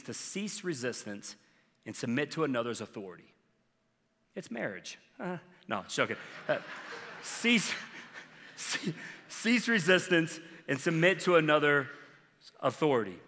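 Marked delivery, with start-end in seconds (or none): none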